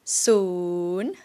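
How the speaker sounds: soon dips low then rises; Thai rising tone. Shown as a demonstration. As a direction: scoop soon down and up. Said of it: "The first one is".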